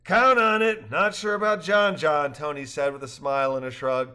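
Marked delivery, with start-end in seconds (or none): 0.1-2.3 higher, louder lively dialogue; cheerful characters. A narrator's reading. Rafters joking after the trip, higher and louder. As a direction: voice the rafters brightly.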